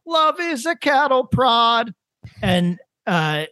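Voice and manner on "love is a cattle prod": high, drawn-out mock singer's wail; obnoxiously high voice. Guy singing over Love is a Battlefield, obnoxiously high. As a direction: imitate an obnoxious high-pitched karaoke singer. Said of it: "with an obnoxiously high voice".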